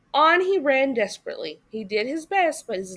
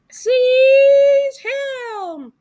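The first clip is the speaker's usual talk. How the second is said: high, loud alarmed cry; loud high shout to grab the runaway; 14 semitones higher, 11 dB louder than usual. A narrator's reading. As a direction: yell it as you lunge for him. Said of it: "they cried".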